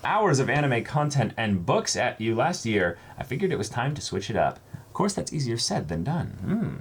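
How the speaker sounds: bright read-aloud voice for the viewer's letter; reading a viewer's kind note to the camera. Acted. Reads fan letter aloud.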